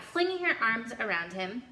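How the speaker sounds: ordinary delivery, nothing marked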